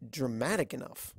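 ordinary delivery, nothing marked

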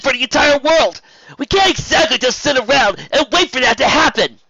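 loud, high, heated argument; offended outburst. A narrator's reading. Spike telling Rarity about the invasion, offended and heated. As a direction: argue back with emotions unmasked. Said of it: "didn't do a fantastic job keeping his emotions masked".

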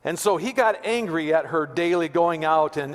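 normal talking voice

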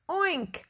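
high, short oink; pig oink. A narrator's reading. Reader voices Piggy for children, high oink. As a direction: squeal out a little piggy oink. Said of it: "said Piggy".

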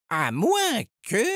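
high, drawn-out hesitant thought; musing aloud. A narrator's reading. Edgar starts to guess what is happening, slow and musing. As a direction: stretch the words as an idea forms. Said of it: "murmura Edgar".

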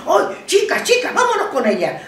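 put-on high, excited girlish cry; excited call. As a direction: call out to the girls. Quoting excited girls calling out.

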